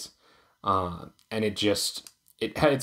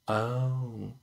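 ordinary delivery

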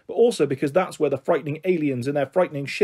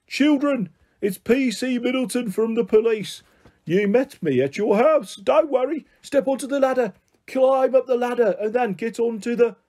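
shouted; 7 semitones higher than usual. A narrator's reading. PC Middleton shouting instructions to children from above, shouted. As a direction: call urgently down to children below. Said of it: "and the shape shouted".

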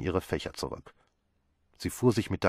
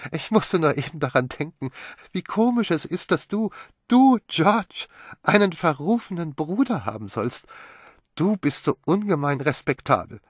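put-on higher, light young wife's voice, teasing; Magdalene amused; 9 semitones higher, 6 dB louder than usual. A narrator's reading. Wife teasing George about his disreputable brother, high and amused. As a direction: tease the stiff husband, voice lifted high.

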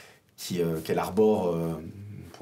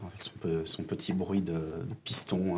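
usual speaking voice

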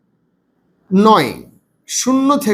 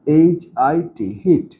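slow spelling-out; 5 semitones lower, slower than usual. Shown as a demonstration. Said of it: "উচ্চারণ করতে শিখবো".

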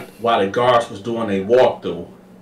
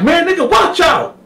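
very high, loud yell; high loud shout; Carl shoving off; 5 dB louder than usual. Retold or imitated. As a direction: get out of my way. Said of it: "Carl like".

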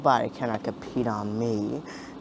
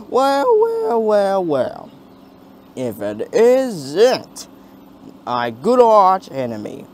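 smug villain; 5 semitones higher, 5 dB louder, slower than usual. Acted. Villain greets his arch enemy.

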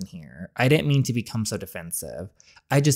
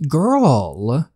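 ordinary talk

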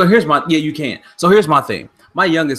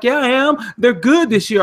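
imitating son; 9 semitones higher than usual. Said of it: "He's like".

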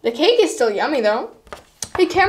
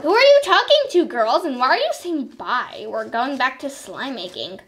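usual speaking voice

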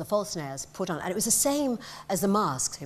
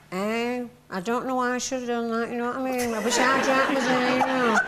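comic character voice; 4 semitones higher, 4 dB louder than usual. Retold or imitated. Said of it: "So I said".

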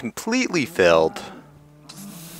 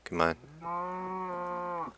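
hushed, drawn-out coax; soft coaxing; 9 semitones higher, 15 dB quieter than usual. Real, not acted. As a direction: gently lure the animal.

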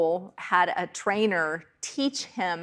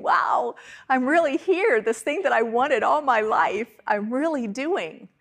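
higher, louder, amazed at being grown up; amazed wonder; 5 semitones higher, 4 dB louder than usual. Retold or imitated. Preacher recalling first days as an adult, amazed. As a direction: marvel at finally living the dream.

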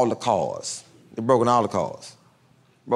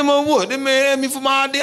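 high, loud, laughing protest; defensive high Al; 3 dB louder than usual. Retold or imitated. Al defending himself to the narrator, high and loud. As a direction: play Al's wounded innocence up high. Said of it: "He said".

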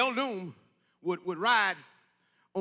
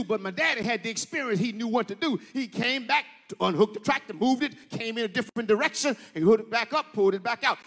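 ordinary delivery

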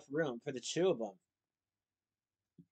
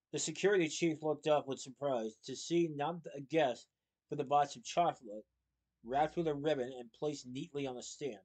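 ordinary delivery

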